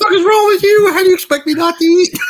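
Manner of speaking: high, loud hammed-up mom yelling; mock angry mom. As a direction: yell it like an outraged mother. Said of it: "my mom's like".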